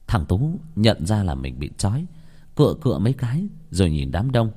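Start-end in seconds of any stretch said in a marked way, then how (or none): none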